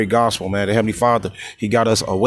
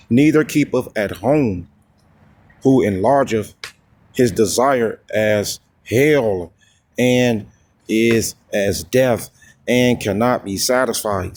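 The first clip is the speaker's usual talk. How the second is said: slow, solemn verse reading; scripture reading; 3 semitones lower, slower than usual. Acted. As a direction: read the verse slowly, drawing it out.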